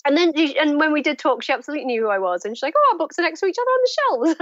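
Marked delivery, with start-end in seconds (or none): 2.8-4.4 high-pitched excited gush; retold excitement. Famous writer greeting a fellow author, imitated high gush. Retold gushing first talk with idol.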